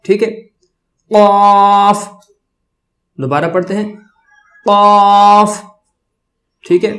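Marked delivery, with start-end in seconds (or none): 1.1-2.1 full-mouthed, drawn-out قاف on a level note; thick, heavy. Shown as a demonstration. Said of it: "موٹا بھی کر رہے ہیں". 4.6-5.6 full-mouthed, drawn-out قاف on a level note; thick, heavy. Shown as a demonstration. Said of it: "موٹا بھی کر رہے ہیں".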